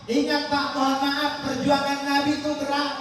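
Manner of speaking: high, fervent sermon voice; preaching at full force. Acted. Preacher urging the congregation to pray, fervent preaching. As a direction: drive the warning home to the congregation.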